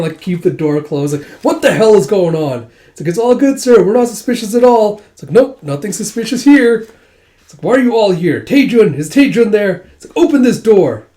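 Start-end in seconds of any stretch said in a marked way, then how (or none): 1.4-2.7 1 mild angry shout. Retold or imitated. General angry behind door. 3.1-6.9 high, loud, hammed-up innocence; nervous fake reassurance. Dragons blocking the general's door, nervously loud. 7.6-11.1 high, loud insistent demand; demanding shouts. Retold or imitated. General demanding to be let out, shouting.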